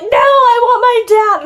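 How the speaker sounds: put-on high, loud, slow wail of a scared kid; crying child. Scared seven-year-old in a ditch begs cousins for her dad, wailing high and slow. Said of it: "And I was, like, crying".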